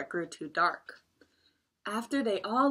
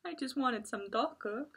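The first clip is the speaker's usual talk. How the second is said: tearful; 7 semitones higher, 6 dB quieter than usual. Acted. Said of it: "Tiger nodded tearfully".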